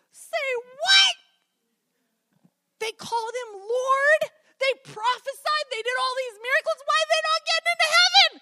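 can't believe what you heard; little kid stunned by the verse; shocked disbelief. Retold or imitated.

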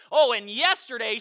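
booming chatty voice; oblivious loud chatter. Acted. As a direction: blurt it out like a clueless noisy friend. Loud friend carries on talking.

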